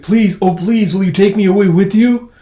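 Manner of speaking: high, loud begging; acted impassioned plea; desperate pleading. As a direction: beg to be taken along. Robot begs to be taken along.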